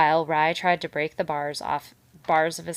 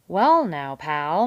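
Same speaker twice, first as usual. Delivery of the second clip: loud, hearty big-fellow voice; hearty, loud beaver voice; 8 dB louder, much slower than usual. A narrator's reading. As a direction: boom it out like a jovial salesman. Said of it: "with his usual heartiness".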